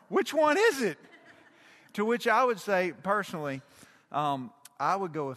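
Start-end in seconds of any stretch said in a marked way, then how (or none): 0.1-1.0 high, loud bewildered question; baffled demand. Retold or imitated. Congregation asks about two pronunciations, loudly baffled. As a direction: cry it out in mock confusion.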